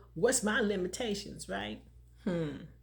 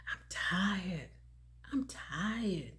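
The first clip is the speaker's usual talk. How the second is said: imitating someone whispering they're tired; soft mock-exhausted whisper; weary whisper; 3 semitones lower, 6 dB quieter, much slower than usual. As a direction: breathe it out like you're worn out. Voicing a weary complaint.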